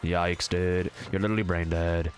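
put-on low, monotone Valorant accent. Showing chat a Valorant accent, low and deadpan. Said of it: "If I had a Valorant accent".

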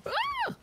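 very high, short startled scream; sudden high squeak of fright; startled shriek. Real, not acted. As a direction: yelp in sudden alarm. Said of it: "Sorry, there was a boar".

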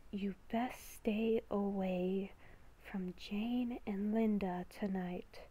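hushed, slow whisper of an inner beast; beast within voice. Acted. Inner beast whispering a warning to Haley, hushed and ominous. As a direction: whisper like a creature living inside her head.

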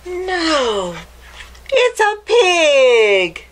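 big storybook reveal; excited reveal. Acted. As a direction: announce the pig like a surprise.

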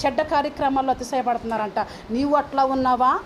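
ordinary talk

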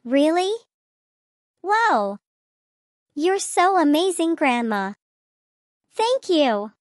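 thrilled. Acted. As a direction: squeal your thanks to grandma. Child thrilled grandma buys it.

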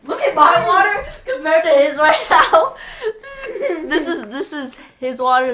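loud laughing complaint. Real, not acted. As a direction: laugh while protesting. Laughing comparing water.

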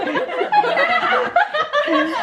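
shrieking giggle; high squealing laugh. Real, not acted. Laughing fit.

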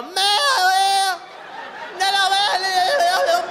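high, loud vowels with the consonants melted away; loose-mouthed slur. Shown as a demonstration. Comic showing heat erasing the phonemes, slack-mouthed shouting. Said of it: "se va aflojando la boca".

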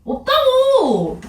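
high, drawn-out irritated protest; annoyed whine. Acted. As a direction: whine it out slowly, fed up. Annoyed kid answers older cousin.

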